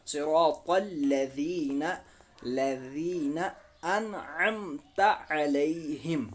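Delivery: slow, drawn-out tajweed recitation; Quran recitation. Shown as a demonstration. Teacher recites the joined phrase for students, slow recitation. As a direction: recite measured, holding the long vowels.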